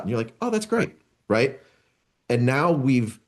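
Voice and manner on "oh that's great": pleased. Acted. Colleague pleased by the explanation.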